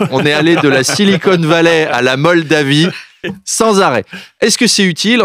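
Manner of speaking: normal talking voice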